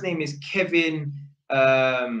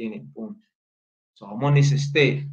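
ordinary talk

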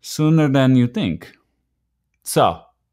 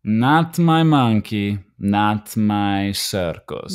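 slow proverb quote; 3 semitones lower, slower than usual. Retold or imitated. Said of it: "Remember what my father said".

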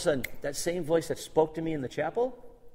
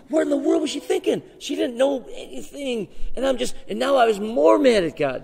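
high, loud disbelief; incredulous outburst; 10 semitones higher, 7 dB louder than usual. Real, not acted. Preacher recalling his shock, loudly incredulous. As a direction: exclaim in disbelief.

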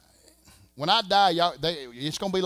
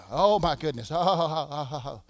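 overwhelmed laugh. Real, not acted. Overcome preacher exclaims, laughs.

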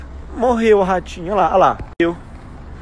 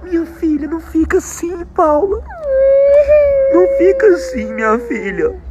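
high, hammed-up soothing; mock consoling; 10 semitones higher than usual. Dad hamming it up, consoling his daughter, high and pleading. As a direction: pretend to calm a crying child.

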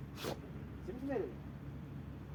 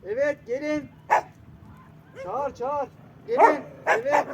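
calling out; 3 semitones higher, 8 dB louder, slower than usual. Real, not acted. Street seller calling passersby to come, loud. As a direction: shout it across the street to bring them in.

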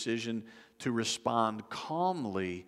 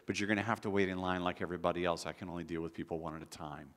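softer, lower, patient explanation; very calm; 4 semitones lower, 4 dB quieter, faster than usual. Retold or imitated. Airline agent to a pushy passenger, very calmly. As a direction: imitate an overwhelmed agent staying calm. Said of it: "tries very calmly to say".

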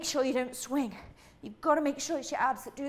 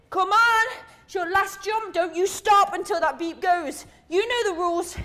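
higher, louder drive to finish; loud coaching push; 5 semitones higher, 7 dB louder than usual. Real, not acted. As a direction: yell them through the last rep. Coach pushing final reps.

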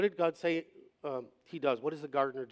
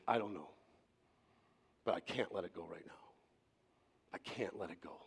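retold hurt; 7 semitones lower, 9 dB quieter than usual. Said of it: "He was deeply hurt by the church".

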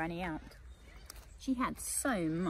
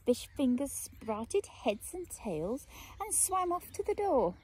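usual speaking voice

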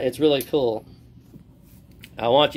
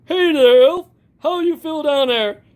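high, hammed-up jolly Santa; 11 semitones higher, 4 dB louder than usual. Animatronic Santa greets the fans in a high jolly voice. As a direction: boom out ho-ho-ho like a toy Santa.

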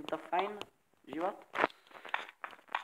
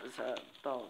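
ordinary talk, nothing marked

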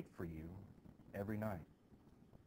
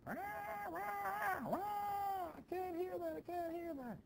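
put-on shrill, much higher wailing child; screaming girl; slower than usual. Betty wakes to hymns and screams, high shrill wail. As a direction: wail it out like a terrified little girl. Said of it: "and starts to scream".

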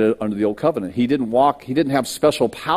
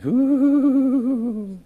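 high, drawn-out "ooh" held on one note; long high hoot. Retold or imitated. Telling how a baby walked around, long high hoot. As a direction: imitate a baby's steady high coo. Imitating a baby's noise while walking around.

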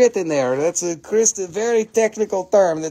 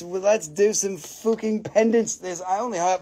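normal talking voice

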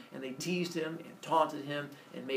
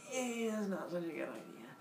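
softer, strained hesitant refusal; reluctant balking; 3 semitones higher, 9 dB quieter than usual. Acted. Father balking at his son's wish, reluctant. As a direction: wince your way through a no.

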